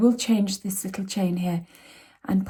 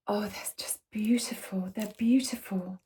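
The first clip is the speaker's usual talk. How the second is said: awed whisper; 7 dB quieter than usual. Real, not acted. First sight of the tiles.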